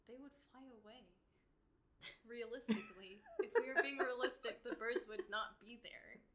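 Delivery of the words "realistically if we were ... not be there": loud, higher, laughing through it; laughing rebuttal. Real, not acted. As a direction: argue it loudly through real laughter. Amused rebuttal amid laughter.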